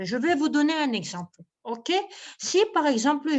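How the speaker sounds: ordinary talk, nothing marked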